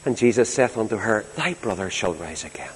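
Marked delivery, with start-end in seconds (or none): 1.3-2.7 very soft, low reassurance; gentle. Acted. Jesus answering grieving Martha, gentle and hushed. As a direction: lower right down into a quiet, tender promise. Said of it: "You see his gentle approach".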